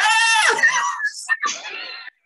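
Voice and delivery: high burst of laughter. Real, not acted. Laughing after coyote imitation.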